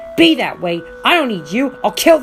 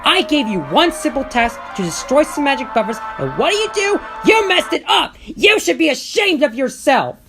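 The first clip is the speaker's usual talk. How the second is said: fuming; 12 semitones higher, 7 dB louder than usual. Acted. Diesel ten scolding his minions, furious.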